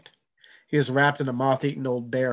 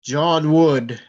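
booming, louder call of a name; thundering voice; 3 semitones higher, 8 dB louder than usual. Acted. Possessed boy booms the name at John, thundering. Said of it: "His voice was like thunder".

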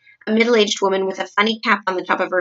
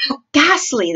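higher, appalled exclamation; horrified cry; 6 semitones higher, 4 dB louder than usual. A narrator's reading. Queen reacting to the newspaper article, horrified cry. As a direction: cry out, leaning hard on the horror. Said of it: "the famous voice cried out".

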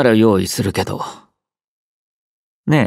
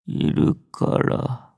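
whisper; 10 semitones lower, 8 dB quieter, slower than usual. Acted. Intimate line to the listener.

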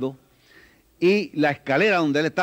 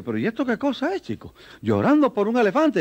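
baffled policeman; 7 semitones higher than usual. Acted. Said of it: "se acerca uno de los policías y dice".

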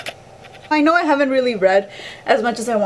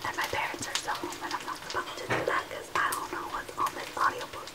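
barely audible whisper; 12 dB quieter than usual. Real, not acted. Explaining why she can't play the audiobook, whispered. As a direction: keep it down so mom can't hear. Whispering, parents are home.

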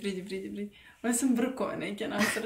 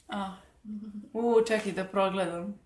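ordinary talk, nothing marked

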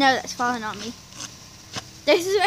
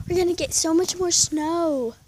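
ordinary talk

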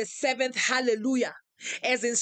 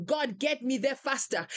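ordinary talk, nothing marked

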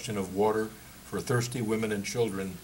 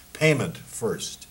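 each word dragged out slowly and heavily; slow demand; 5 dB louder, much slower than usual. Acted. German guard to emigrants, slow firm demand. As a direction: make him wait on every syllable.

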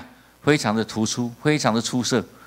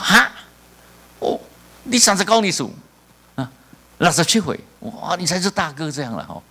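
triumphant gotcha; 5 semitones higher than usual. Retold or imitated. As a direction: crow over the old man's real age. Pastor triumphantly shows his ID.

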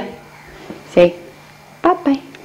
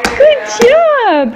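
much higher, sing-song praise; 9 semitones higher than usual. Real, not acted. Mother cheering her toddler's first steps, high baby talk.

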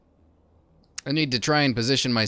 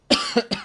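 one sharp loud cough; coughing fit; 7 dB louder than usual. Real, not acted. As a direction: cough hard into the mic. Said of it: "Sorry I'm coughing so much".

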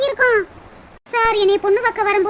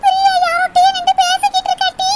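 higher and louder, excited village call; 7 semitones higher, 5 dB louder than usual. Acted. Neighbour spots Priya with a man, calls out loudly. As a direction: call your friend over in excitement.

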